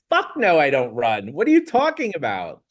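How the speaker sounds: high, loud disbelieving no; incredulous outburst. Retold or imitated. Telling the trainer no to running, high incredulous outburst. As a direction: scoff at the idea.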